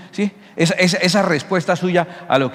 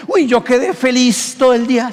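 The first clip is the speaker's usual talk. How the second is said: light mock delight; 6 semitones higher than usual. Alegría por la visita.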